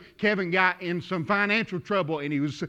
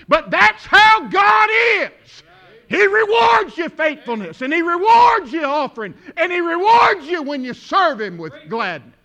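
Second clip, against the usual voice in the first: building to a loud, high, slow preacher's shout; impassioned pulpit shout; full-force preaching; 7 semitones higher, 9 dB louder than usual. Real, not acted. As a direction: drive each phrase home at full voice.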